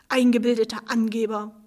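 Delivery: plain reading voice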